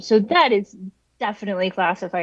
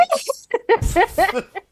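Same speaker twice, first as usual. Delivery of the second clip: high burst of laughter; embarrassed laugh; 12 semitones higher than usual. Real, not acted. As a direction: break into laughter. Laughs at own impression.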